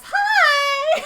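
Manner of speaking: very high, loud shout; bright shouted hi. Retold or imitated. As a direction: pop up behind them and shout hello. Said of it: "she goes".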